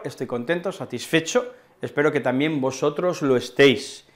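ordinary delivery

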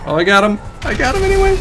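high triumphant cry; excited. Real, not acted. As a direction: cheer the kill. Killing enemy.

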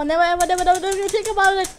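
ordinary talk, nothing marked

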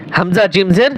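ordinary delivery

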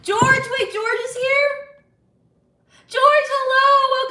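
high, loud, drawn-out hello; excited greeting. Real, not acted. Streamer spots George in the chat, excited high greeting. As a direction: squeal a welcome at a friend's arrival. Greeting a viewer who just arrived.

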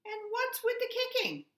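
high, indignant question. A narrator's reading. Goose scolds the bluebird, indignant high voice. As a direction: demand an answer.

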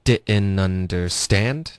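very slow "didn't understand" as di'n-nunderSTAND; linked drill model. Shown as a demonstration. Teacher modelling a segment, slow linked "didn't understand". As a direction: drop the t in "didn't understand", rising slowly. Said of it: "我们来分段练一下".

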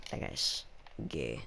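suddenly low, breathy drop. Real, not acted. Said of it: "I don't know why my voice got deeper".

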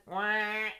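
duck quack. Acted. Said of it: "said the duck".

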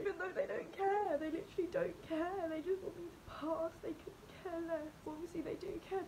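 high, teary pleading words; crying. Real, not acted. Upset student crying about teachers and grades. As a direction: speak through real crying. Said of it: "why am I crying".

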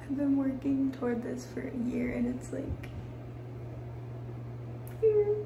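soft, halting, close to real tears; choked up, pausing to keep it together; trying not to cry. Real, not acted. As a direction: hold back tears, voice small and quiet. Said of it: "I'm trying not to cry".

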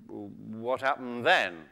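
low, slow, soft creature voice; interested tiger. Acted. Tiger drawn into the muskrat's story, low slow curiosity. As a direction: draw it out softly, intrigued. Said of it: "said the tiger, who's getting quite interested".

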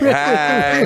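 high, loud hammed-up smiling hello; smiling greeting. Comic voicing his dead grandmother grinning from the casket, mock-cheery. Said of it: "Do you want her smiling".

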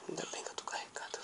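quick whispered relief; whispering. Real, not acted. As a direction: whisper it to yourself, fast. Relieved aside in game.

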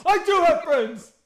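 high, loud and slow, put-on voice; mock indignant insistence. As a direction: insist like the offended guy. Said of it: "imitating homeless guy".

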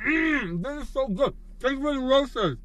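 high, delighted mmm of pleasure; savoring delicious food. Real, not acted. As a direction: melt over the taste. Tasting the milkshake.

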